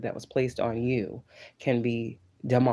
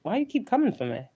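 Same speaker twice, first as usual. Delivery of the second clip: much higher and quick, exasperated "why don't you just stop"; annoyed high priestess; 11 semitones higher, faster than usual. Retold or imitated. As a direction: play the fed-up priestess snapping back, fast and high. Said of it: "That's the high priestess".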